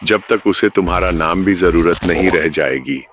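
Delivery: ordinary delivery, nothing marked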